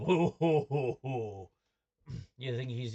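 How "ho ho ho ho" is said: louder, booming alien chuckle; Jabba the Hutt laugh. Pretend. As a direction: ham up a big slug-gangster laugh. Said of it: "like Jabba the Hutt".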